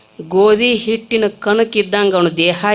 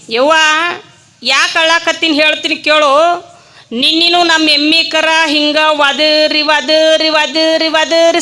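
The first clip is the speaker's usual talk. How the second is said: loud, high mock old village woman complaining; preacher imitating the old woman, loud and high; old woman's voice; 7 semitones higher, 4 dB louder than usual. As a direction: ham up the wailing granny, half laughing. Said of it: "ಆಕೆ ಅಂದ್ಲು ಮುದುಕಿ".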